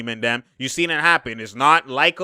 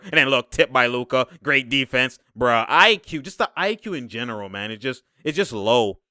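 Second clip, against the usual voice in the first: usual speaking voice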